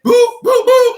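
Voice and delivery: high, loud mock boo sounds; imitating boo. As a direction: squeak out the boos like the comedian.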